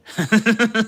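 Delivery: short laugh at his own pun; a bright amused laugh; cracking up at his own joke; burst of laughter. Real, not acted.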